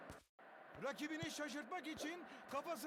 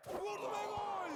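goal call, pitched up and louder; screaming football commentator; 5 semitones higher, 4 dB louder than usual. Acted. Game commentator calls a goal.

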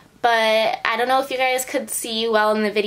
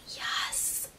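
whispering; 13 dB quieter than usual. Real, not acted. Hushed yes to herself.

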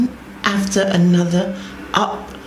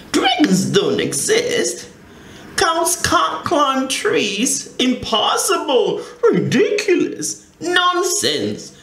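higher, mocking dismissal with a laugh; the sisters laughing at Tina's nonsense, scornful; scoffing sisters; 10 semitones higher than usual. A narrator's reading. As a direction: laugh off a silly idea, higher. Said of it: "they said".

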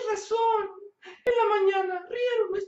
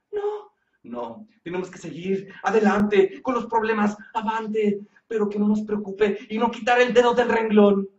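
much lower, steady preachy husband; a lightly voiced deep-toned husband giving a pep talk; low calm man; 10 semitones lower than usual. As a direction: lecture her like a wise mentor. [name] lectures in low voice.

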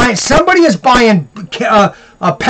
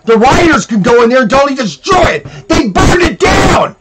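furious shout; 3 semitones higher than usual. Real, not acted. Host ranting about burned restaurant, furious crying shout. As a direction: yell it out in outrage.

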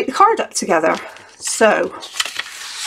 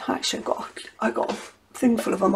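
whispered aside; 7 dB quieter, faster than usual. Real, not acted. Muttered aside while searching.

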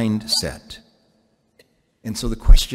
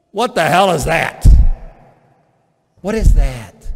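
loud mocking disbelief, higher and louder; incredulous; 5 semitones higher, 9 dB louder than usual. Real, not acted. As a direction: scoff at the jargon. Mocking indignation at the jargon.

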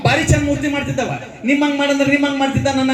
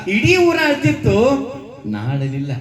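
dramatic recounting. Acted. Bereaved mother retells her losses.

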